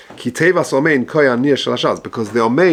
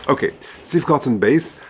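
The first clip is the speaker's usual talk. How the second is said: usual speaking voice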